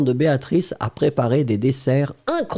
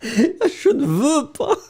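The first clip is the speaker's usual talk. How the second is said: laughing mock whine; 12 semitones higher, slower than usual. Teacher playfully reads comment.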